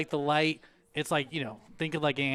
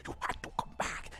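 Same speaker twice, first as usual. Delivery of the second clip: soft, eerie whispered voice; creepy pumpkin; 11 dB quieter than usual. Acted. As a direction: whisper it close, as an evil pumpkin. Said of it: "it sounds creepy".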